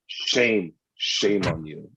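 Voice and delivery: low, slow theatrical tut of shame; mock judge scolding the opposing side; mock solemn shaming. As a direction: shame him like a stern elder, hammed up.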